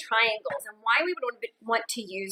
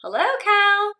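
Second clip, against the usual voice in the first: higher, louder friendly hello; cheerful duck greeting; 10 semitones higher, 7 dB louder, slower than usual. Acted. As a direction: greet the cow brightly as Duck.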